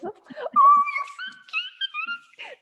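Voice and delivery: put-on very high, slow cooing baby talk. Shown as a demonstration. Speaker showing her baby voice, very high slow cooing. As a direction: coo up high like talking to a baby. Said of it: "my voice goes up, like, ten octaves".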